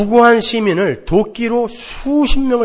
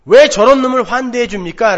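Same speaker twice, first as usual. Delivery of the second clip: louder, higher, resentful question; angry protest; 5 semitones higher, 7 dB louder than usual. Retold or imitated. Elder son angry at his father welcoming the brother, resentful protest. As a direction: throw it back at the father. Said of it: "화를 내면서".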